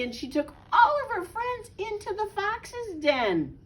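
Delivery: high, exasperated rant; hammed-up exasperation; playful outrage. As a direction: protest high-pitched, as if scandalised by the story. Reader exasperated at Henny Penny.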